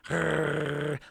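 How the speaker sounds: imitated dog growl. Vlogger voices the dog at the plasma, mock growl. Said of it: "The dog is like".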